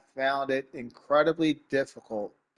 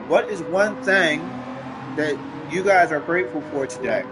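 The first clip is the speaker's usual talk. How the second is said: streamer hype voice; 5 semitones higher, 4 dB louder than usual. Real, not acted. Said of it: "get my nug voice back".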